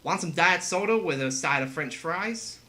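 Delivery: hammed-up American accent; American fast-food server caricature. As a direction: put on a cartoonish American accent. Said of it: "the accent that I do for Americans is very stereotypical".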